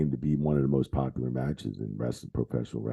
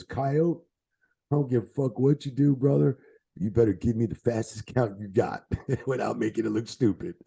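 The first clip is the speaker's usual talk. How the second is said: Razor imitation; 7 semitones higher than usual. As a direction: boss the referee like a cocky wrestler, pitched high. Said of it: "he goes".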